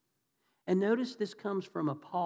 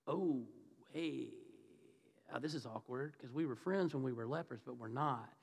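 low, soft, halting awkward excuse; sheepish, hesitant mumbled excuse; awkward brush-off; 5 semitones lower, 9 dB quieter than usual. Acted. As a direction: fumble an uncomfortable brush-off to an old friend. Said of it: "can you imagine how awkward that was?".

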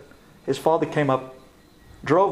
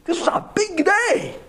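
panicked friends; 10 semitones higher than usual. Retold or imitated. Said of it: "they're looking at each other".

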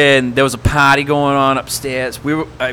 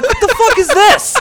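incredulous; 4 dB louder than usual. Retold or imitated. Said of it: "I'm like".

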